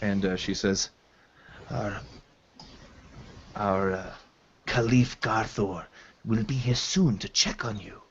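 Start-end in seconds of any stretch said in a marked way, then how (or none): 1.7-8.0 a hint of a soft foreign healer, quieter; gently reassure, stumbling over the language; lightly voiced hobgoblin woman. Hobgoblin tells the wounded captive she slept four days, softly.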